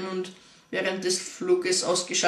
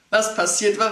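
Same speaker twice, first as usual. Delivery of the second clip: ordinary delivery, nothing marked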